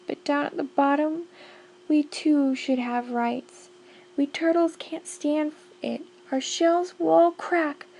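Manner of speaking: high, strained pleading groan; groaning plea. Acted. Mac groans up to the king, high and pleading. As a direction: beg for mercy from the bottom. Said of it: "groaned Mac".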